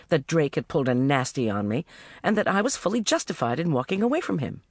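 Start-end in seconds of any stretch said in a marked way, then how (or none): none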